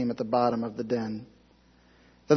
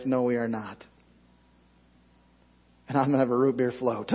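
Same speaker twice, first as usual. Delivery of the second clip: ordinary talk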